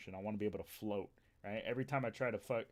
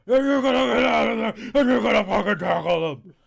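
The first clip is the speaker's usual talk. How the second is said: much higher, much louder raspy yell; raspy coach yell; 12 semitones higher, 21 dB louder than usual. Retold or imitated. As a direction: bark it like a hoarse coach on the sideline. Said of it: "coaches with the raspy voice yells".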